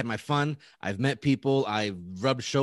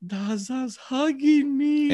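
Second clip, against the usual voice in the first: high, slow, awed squeal; hammed-up swooning disbelief; starstruck gushing; 12 semitones higher, much slower than usual. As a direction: draw it out in high-pitched fan bliss. Starstruck inner voice while hugged.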